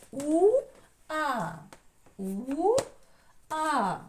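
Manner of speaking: У-а chanted as two long, high, separate vowels; two separate syllables. Shown as a demonstration. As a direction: sing out «У» then «а», split apart. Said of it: "Є два склада". («У-а» протяжно, високо, по складах)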